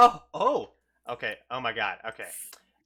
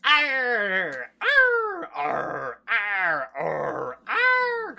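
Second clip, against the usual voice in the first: pirate roar; 7 semitones higher, 6 dB louder, much slower than usual. Acted. Pirate captain roaring arr.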